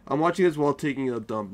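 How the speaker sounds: normal talking voice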